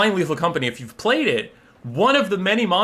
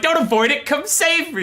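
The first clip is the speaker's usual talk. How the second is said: very high, urgent plea; frantic pleading; 11 semitones higher than usual. Retold or imitated. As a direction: beg for rescue, voice shooting up. Acted plea to friend for rescue.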